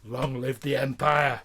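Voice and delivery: low menacing salute; evil empire proclamation; dark lord. Acted. Villain salute.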